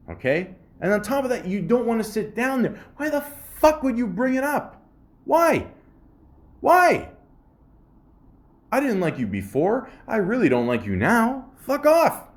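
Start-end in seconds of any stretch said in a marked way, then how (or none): 3.0-7.1 rising, ever higher and louder why; ranting at the rude passenger, climbing to a yell; exasperated rant. Real, not acted. 11.6-12.3 high, loud fuck off; fed-up fuck off. Real, not acted. Ranting at rude passenger.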